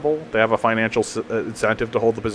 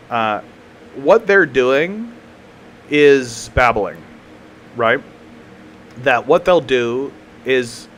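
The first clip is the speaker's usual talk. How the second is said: ordinary talk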